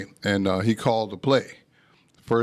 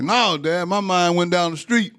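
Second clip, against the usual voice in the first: higher, louder mocking comeback; cheeky defiance; 8 semitones higher, 4 dB louder than usual. Son provoking his angry father, cheeky loud comeback. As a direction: stir up trouble with a smart reply. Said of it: "I tried to stir it up".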